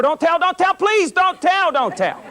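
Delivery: high-pitched mock frantic begging; panicked pleading. Caught teenager begging the police officer not to tell, mock panicked pleading. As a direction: imitate a girl pleading in a panic. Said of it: "She goes into D Ts".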